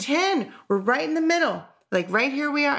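high, bouncy retelling of someone excited; imitated excitement. Partner happy to be mid-series, imitated as excited and high. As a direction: mimic an eager partner, pitched well up. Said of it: "and he's, like, excited".

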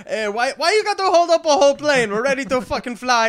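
put-on high, loud rant in an Israeli accent; Israeli-accented berating pilot. Pilot scolding a passenger who holds up the plane, Israeli-accented yelling. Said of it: "he literally berates me".

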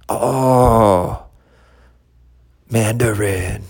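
put-on slow, drawn-out Chinese accent. Comic acting a cartoon's punchline, Chinese accent. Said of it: "Chinese accent".

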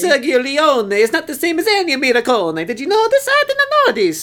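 indignant cartoon Italian, climbing very high; high, theatrical mock-Italian; Italian accent. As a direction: scold in a hammed-up Italian accent. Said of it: "make this offensive Italian accent".

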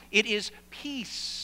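It is peace, with peace slowed and stretched out; said slowly. Shown as a demonstration. Pastor modeling the word for the congregation, slow and drawn out. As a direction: take your time on peace. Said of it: "you have to say it slowly to make it sound right".

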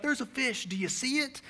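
high, eager captain pointing at the water; excited fishing captain. Retold or imitated. Captain excitedly showing the pastor a fish, high and eager. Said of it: "He was so excited".

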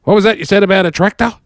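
put-on high, loud bewildered old voice; confused old man. Old painter on the phone, mishearing Bo. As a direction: shout it like a deaf old man. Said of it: "and he goes".

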